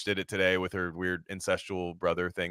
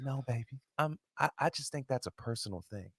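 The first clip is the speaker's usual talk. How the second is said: much softer, hushed smooth mock voice; 2 semitones higher, 8 dB quieter, faster than usual. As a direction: coo it softly like a cool celebrity dodging the question. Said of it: "and he just goes".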